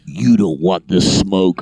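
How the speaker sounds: slow, low menacing line; a slow hard-man warning; slow put-on tough-guy threat. Bully's threat, quoted.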